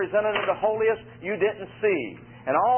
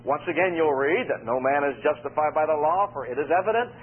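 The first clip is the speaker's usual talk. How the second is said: usual speaking voice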